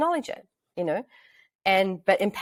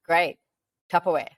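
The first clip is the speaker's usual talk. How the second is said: unimpressed. Retold or imitated. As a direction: imitate a partner who couldn't care less. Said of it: "can you just get real excited for me".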